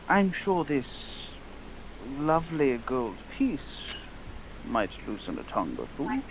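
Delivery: leisurely, insinuating gentleman; smooth briber. Acted. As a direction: draw it out temptingly, word by word. Player offers bribe in character.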